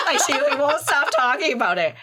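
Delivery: real laughter bubbling up; laughing. Real, not acted. Laughing at mom's insistence.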